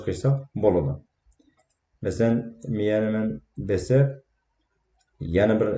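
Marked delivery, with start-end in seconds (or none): none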